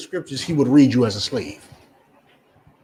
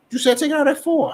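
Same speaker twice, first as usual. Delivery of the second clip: higher, quick defensive reply; protesting sister; 11 semitones higher, faster than usual. Retold or imitated. Sister defending herself to mother, higher and quick. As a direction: squeak back in self-defense.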